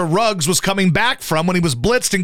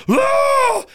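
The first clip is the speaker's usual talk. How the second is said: horror killer shriek. Retold or imitated. Imitating a horror killer's shriek from the stretcher. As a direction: leap out with a shrill movie-killer cry. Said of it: "like she's the killer at the end of a horror movie".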